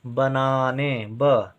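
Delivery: बनाने drawn out slowly, syllable by syllable; teacher spelling out बनाने slowly for a pupil. Shown as a demonstration. Teacher to pupil, slow dictation of बनाने. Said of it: "बा के साथ कोई उच्चारण नहीं".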